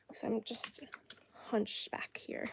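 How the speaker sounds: whispered aside, no voice; a hushed self-mocking whisper about being hunched. Real, not acted. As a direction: breathe it out under your breath.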